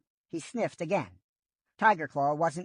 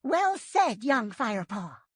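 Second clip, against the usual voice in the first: put-on higher, urgent old she-cat voice; Yellowfang's voice; 7 semitones higher than usual. A narrator's reading. Old she-cat offering help from Shadow Clan, higher voice. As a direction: voice the old medicine cat, hopeful.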